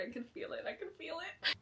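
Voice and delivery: breathless excitement building; giddy. Real, not acted. Book changing her brain chemistry.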